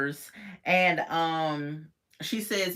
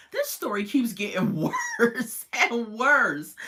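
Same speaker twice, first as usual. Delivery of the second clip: laughing disbelief; 5 semitones higher, 4 dB louder than usual. Real, not acted. Amused at the story.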